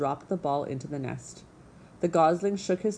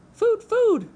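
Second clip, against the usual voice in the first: put-on very high, loud baby-bird squeal; peeping gosling; 9 dB louder than usual. A narrator's reading. Hungry gosling begging the robot, high loud peep. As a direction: demand it in a piercing chick's peep. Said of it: "peeped the gosling".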